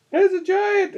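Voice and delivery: very high-pitched alarm cry; mock yell. Player's character warns everyone of a giant, high mock yell. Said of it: "maybe yell something".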